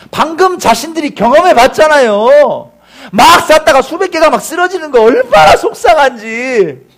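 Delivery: high, loud, impassioned sermon; full-force preaching. Real, not acted. Preacher recalls the congregation's own upset, full-force preaching. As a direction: drive it home to the congregation.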